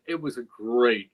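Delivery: ordinary delivery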